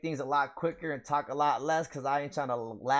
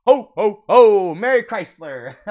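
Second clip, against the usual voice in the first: loud hearty mock "ho, ho, ho"; a hammed-up Santa laugh, then "Merry Chrysler"; 6 semitones higher, 14 dB louder than usual. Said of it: "funny meme ... saying Merry Christmas wrong".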